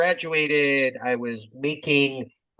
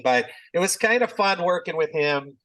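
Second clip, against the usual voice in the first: ordinary talk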